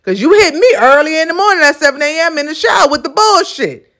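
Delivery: high, emphatic mock retort; sassy universe talking back. The universe answering her complaint, mock scolding. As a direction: fire back high, stretching the first words. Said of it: "And the universe is gonna say".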